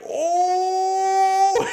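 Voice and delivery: high, loud, squeaky shocked "Oh"; mock shocked gasp. John reacts in shock to Jesus, squeaky and high. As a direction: play it like a stunned gasp.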